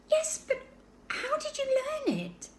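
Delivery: put-on high, wondering girl's voice; astonished, asking gently; little girl Laura. A narrator's reading. Sister puzzled by talking baby, high and wondering.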